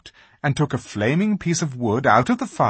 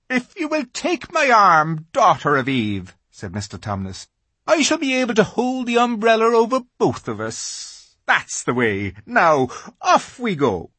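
fussy fawn; 9 semitones higher, 3 dB louder than usual. A narrator's reading. Tumnus offers his arm.

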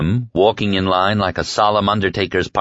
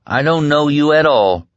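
higher, firmly voiced denial; clearer denial; 6 semitones higher, 4 dB louder than usual. A narrator's reading. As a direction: say it out clearly, pitched up. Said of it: "a little more clearly this time".

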